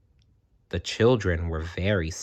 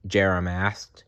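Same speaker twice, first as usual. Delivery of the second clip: ordinary delivery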